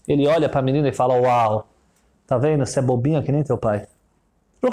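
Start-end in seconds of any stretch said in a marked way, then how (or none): 1.2-3.9 low, condescending rich man; rich man's voice. Retold or imitated. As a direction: talk down to the child, low and sure. Rich man mocks the girl.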